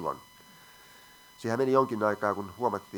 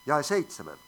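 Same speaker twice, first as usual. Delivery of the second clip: normal talking voice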